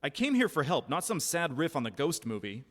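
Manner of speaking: shouting in livid anger. Acted. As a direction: let the fury out at him. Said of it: "I was livid".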